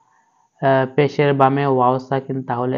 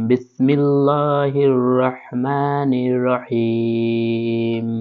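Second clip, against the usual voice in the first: very slow, drawn-out chanted recitation; melodic Quranic recitation; much slower than usual. Shown as a demonstration. Teacher reciting the basmala slowly before the surah. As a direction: stretch each syllable in devout recitation.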